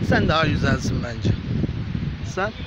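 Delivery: loud, high coaxing voice for animals; high excited pet talk. Acted. Talking to street cats, loud high excited coaxing. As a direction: call them in eagerly, loud and high.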